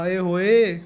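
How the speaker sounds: teasing street hoot, drawn out; tossing a cheeky catcall at a stranger; mock catcall. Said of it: "कहता".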